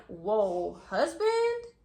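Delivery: incredulous, pitch shooting up on the last word; retold shock. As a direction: act out stunned disbelief. Shocked at learning he is married.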